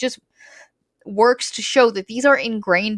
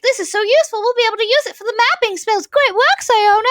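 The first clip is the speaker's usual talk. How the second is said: high, fast, louder gushing praise; mock fawning mages; 13 semitones higher, 3 dB louder, faster than usual. Archmages praising Siona's mirror, high fast gushing. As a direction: gush like pompous archmages, pitched way up. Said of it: "And they're like".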